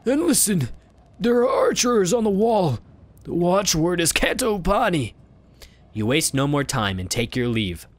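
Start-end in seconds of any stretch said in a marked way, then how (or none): 0.0-5.1 put-on old beggar voice. Beggar giving advice.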